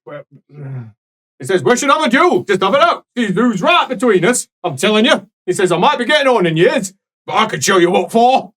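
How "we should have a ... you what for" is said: put-on loud, raised barking old father; hammed-up grumpy priest voice; gruff angry old priest. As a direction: bellow like an outraged old cleric.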